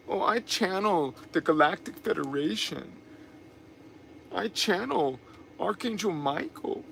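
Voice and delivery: quiet teasing impression of channelers; mock channeler. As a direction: parody a New Age believer showing off, hushed. Mocking New Age channelers.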